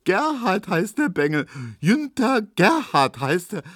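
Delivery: high, gleeful reading broken by laughs; laughing shouting. A narrator's reading. Tesch showing everyone the paper, laughing and shouting. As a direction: crow it out, beside yourself with amusement. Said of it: "lachte und schrie".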